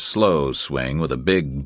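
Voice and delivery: ordinary delivery, nothing marked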